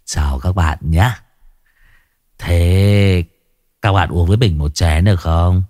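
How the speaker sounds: put-on a lightly slurred drunk pest; drunk slurring. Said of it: "giọng lè nhè".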